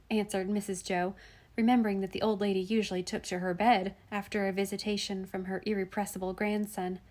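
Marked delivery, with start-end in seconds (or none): none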